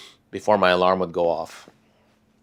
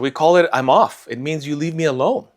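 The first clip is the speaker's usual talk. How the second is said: indignant retort; 7 semitones higher, 4 dB louder than usual. Retold or imitated. Retold retort to stakeholder.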